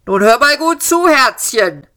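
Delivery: high, condescending loudhailer call; megaphone voice. A narrator's reading. Police mock the fugitive through a megaphone, condescending. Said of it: "sagte die Megafonstimme".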